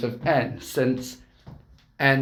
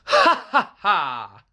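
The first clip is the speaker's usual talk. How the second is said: manic laugh; 3 semitones higher, slower than usual. Acted. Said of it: "Tex was laughing manically".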